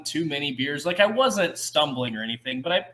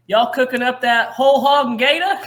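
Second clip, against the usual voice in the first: put-on loud Southern accent; 7 semitones higher, 8 dB louder than usual. Calling out to a barbecue place in a loud Southern accent. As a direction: call out in a hammed-up Southern accent. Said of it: "not shouted, but said very loudly".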